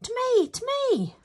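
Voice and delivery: high, loud player's shout; calling for ball. Acted. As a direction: yell for a pass, pitched high. Players calling during practice.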